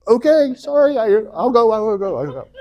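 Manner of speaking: high, hurried, nervous giving in; hasty backing down. Retold or imitated. Moses, faced with God's wrath, backing down in high nervous haste. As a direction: scramble to appease. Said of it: "wrath of the Lord was kindled".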